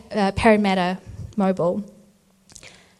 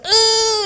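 contraction groan; 13 semitones higher, 4 dB louder than usual. Retold or imitated. Hit by a contraction.

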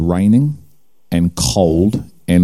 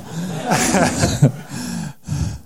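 breathy laugh; 9 semitones higher, 6 dB quieter than usual. Real, not acted. Laughs at piranha joke.

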